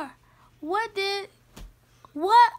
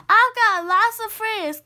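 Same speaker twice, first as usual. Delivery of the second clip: higher, louder happy boast; excited child; 6 semitones higher, 7 dB louder than usual. Acted. Kitten telling mom after first school day, excited. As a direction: burst out with the good news.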